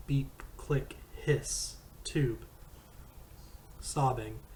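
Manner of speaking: ordinary delivery, nothing marked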